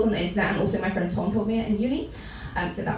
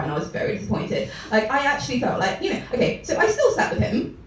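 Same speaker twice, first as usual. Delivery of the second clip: ordinary talk, nothing marked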